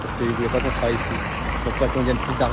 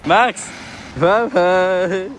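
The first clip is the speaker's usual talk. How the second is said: loud, high farewell call; calling out goodbye; 7 semitones higher, 6 dB louder, much slower than usual. Real, not acted. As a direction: call it out with a long held last word. Calls goodbye to Max. (au revoir lancé bien fort)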